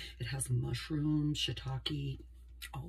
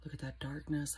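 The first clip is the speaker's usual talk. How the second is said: usual speaking voice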